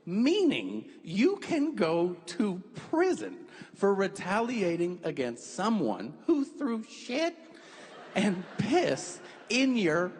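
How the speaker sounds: drawn-out, incredulous punchline delivery; deliberate explanation. Retold or imitated. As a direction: spell it out slowly with mounting disbelief. Acting out the absurd rule.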